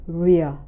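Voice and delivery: "real," low and sinking; falling tone. Shown as a demonstration. Teacher isolating the first part, low falling tone. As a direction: let "real," slide down low, cut from its ending. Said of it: "the falling tone".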